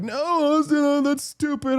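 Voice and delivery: high caricatured objection; silly high-voiced mock dismissal; mocking young men. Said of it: "they're all like".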